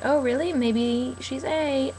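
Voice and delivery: slow, drawn-out fake innocence, pitched a touch high; Mona, mock sly. Fan retelling a scene, slow mock-innocent Mona. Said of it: "And then Mona was like".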